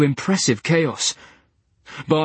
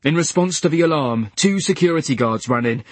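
usual speaking voice

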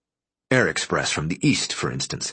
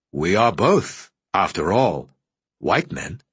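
slow, deliberate parting words; measured visitor. A narrator's reading. At the doorway, the visitor says a slow pointed goodbye. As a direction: let the last words land slowly.

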